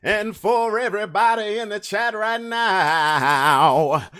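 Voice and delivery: high, slow, drawn-out call to the viewers; drawn-out shout-out to Instagram and chat; hype announcer call-out. Real, not acted. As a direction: stretch it out like a hype man. Calling out to viewers.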